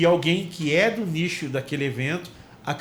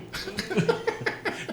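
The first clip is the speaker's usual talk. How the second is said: high laugh; 4 dB quieter than usual. Real, not acted. Laughs at the joke.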